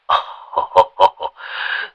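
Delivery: breathy smug chuckle. A narrator's reading. Sorcerer relieved on the phone, smug chuckle. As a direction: laugh it off, relieved. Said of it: "cười hình hạch".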